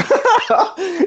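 high-pitched burst of laughter; laughing. Real, not acted. Teller amused by own old pickup line, laughing.

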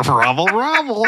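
high, slow "Robble, robble" grunt; Hamburglar voice. Acted. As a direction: mumble it like the Hamburglar. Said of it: "And he goes, like".

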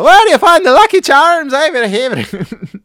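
hammed-up Irish tourist; mock Irish accent. Tourist asks strangers in a mock Irish accent. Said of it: "I'll keep asking people".

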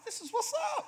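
way up high, squealing with delight; mocking his naive teenage thrill; giddy excitement. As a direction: shriek it like free money. Naive excitement over low payment.

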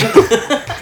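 high loud burst of real laughter. Real, not acted. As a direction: crack up at yourself. Laughing at own accent.

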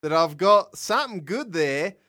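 wobbly mock-Australian accent; Australian accent slipping English. Shown as a demonstration. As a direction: start Australian, drift toward England as you plead. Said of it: "your accent was good for half".

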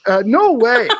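high, loud startled exclamation; shocked yelp. Real, not acted. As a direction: burst out in disbelief. Reacting to own spooky story.

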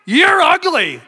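high-pitched parrot insult; squawking parrot. Acted. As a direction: taunt her in a high bird voice. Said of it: "parrot did the same thing".

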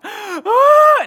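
sharp gasp into a high, loud cry; gasp, then squeal in mock shock. Wife's reaction to new car.

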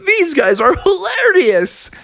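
very high delighted frog voice; amused Froggy. Acted. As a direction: squeal it with amusement. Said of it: "And Froggy was like".

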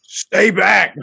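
high, loud cop shout; mock cop. Co-host mocks Israeli-trained police officer, shouting. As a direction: yell it like a cop through a grin. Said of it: "Israeli trained L A P D officer".

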